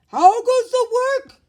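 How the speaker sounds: high, loud lazy farmer calling out. A narrator's reading. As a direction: bellow it from bed like the lazy farmer. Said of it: "called the farmer".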